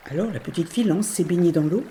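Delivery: ordinary delivery, nothing marked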